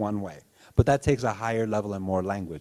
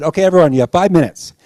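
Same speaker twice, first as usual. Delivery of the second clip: loud, higher teacher voice; teacher announcing; 5 semitones higher, 9 dB louder, faster than usual. Retold or imitated. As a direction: call it out to the class. Teacher voicing classroom announcement.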